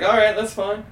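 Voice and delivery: high, bright fake-innocent hello; excited stoned greeting. Stoned teen answering grandma's call, high and overexcited. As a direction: sound way too excited to be normal. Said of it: "And I was like".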